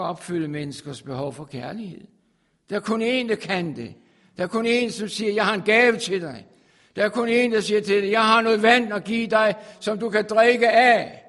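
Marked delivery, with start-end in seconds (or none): 2.7-8.1 preaching louder and louder; rising sermon. Acted. Preacher calling out to his congregation, building in force. 8.1-11.2 preached quote. Acted. Quoting Jesus's offer, loud.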